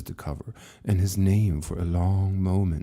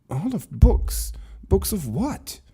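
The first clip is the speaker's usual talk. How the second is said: higher, louder disbelieving cry; incredulous; 12 semitones higher, 3 dB louder, slower than usual. Acted. Lucas demands answers from the keeper, incredulous and higher. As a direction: unable to believe what he hears.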